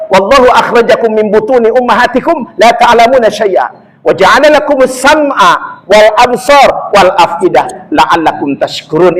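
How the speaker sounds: measured, chanted Arabic recitation; Quran recitation. Acted.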